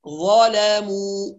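slow "ظلموا" with dark "ظ", then thin "لا" and "مو"; heavy zaa, light laam. Shown as a demonstration. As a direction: make only the "ظ" of "ظلموا" heavy. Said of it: "تفخيم الظاء وحدها وترقيق بقية الحروف". ("ظلموا" بتفخيم الظاء وترقيق اللام والميم)

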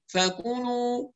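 "فكلوا" through the nose, slowly, "fakuunuu"; nasalised vowels. Shown as a demonstration. Teacher imitates a frequent mistake, nasalised vowels. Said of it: "نطق الحروف المضمومة من الخيشوم".

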